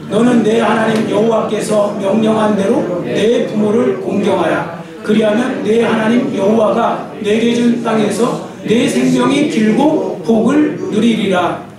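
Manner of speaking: slow, solemn unison reading; slow scripture reading. Shown as a demonstration. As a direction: read the verse slowly and solemnly. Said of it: "같이 읽습니다". (천천히 장엄한 성경 봉독)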